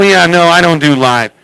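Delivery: usual speaking voice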